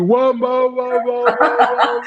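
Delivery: high, loud garbled whoas; imitated heckler. Audience member cutting across the singer's line, imitated heckler. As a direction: break in with a wailing crowd voice. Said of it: "someone in the audience that was doing this".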